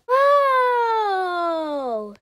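loud, high cry of amazement; amazed whoa. Acted. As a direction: gasp it out at the huge mountain. Sees how high the mountain is.

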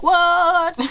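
loud, high-pitched mock "what?"; imitated shock. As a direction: exclaim like a stunned onlooker. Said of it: "some women still get shocked".

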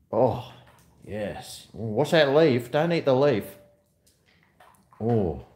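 normal talking voice

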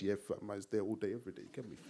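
low, soft London street mumble; teenage wannabe gangster. Acted. Kicked-out brother at the door, low gangster voice. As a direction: play it hard, low and quiet. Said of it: "He's at the door like this".

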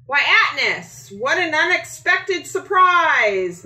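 high, loud, surprised hello; a delighted start at an unexpected guest; surprised greeting. A narrator's reading. Missus Claus greeting Atnis at her door, high and surprised. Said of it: "Missus Claus says".

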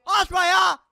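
real frustrated yell, high and drawn out; exasperated shout. Real, not acted. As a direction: shout it at the end of your rope. Yayını kesilince çileden çıkıp bağırıyor. (sinirden avazı çıktığı kadar bağırarak)

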